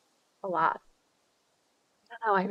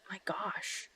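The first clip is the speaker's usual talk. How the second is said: whisper; 13 dB quieter than usual. Real, not acted. Streamer gushing over chat answer.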